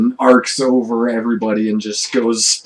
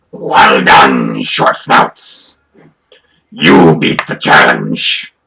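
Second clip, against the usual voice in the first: loud, drawn-out arena announcer; slow booming shout to a crowd; kobold addressing the gathering; 7 dB louder, slower than usual. Acted. Offneeds congratulates the winners before the gathering, slow loud shout. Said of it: "stands up and addresses the gathering".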